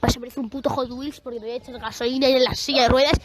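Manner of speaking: normal talking voice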